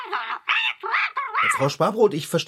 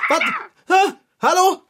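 calling into phone; 14 semitones higher than usual. Acted. On the phone after Frau Sparbrot hangs up, calling out. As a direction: call out, checking whether anyone is still there. Phone call, caller hung up.

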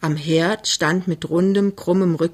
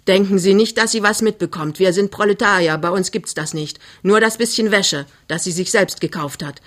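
raised, emphatic warning; suddenly very cross; 2 semitones higher than usual. Acted. Mother warns suitor about dowry, suddenly angry. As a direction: flare up and lay down the law. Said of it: "plötzlich wieder ganz böse".

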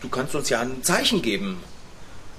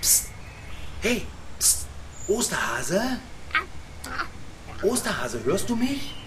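hushed calling out; 7 semitones higher, 7 dB quieter than usual. Acted. Calling rabbit secretly from window.

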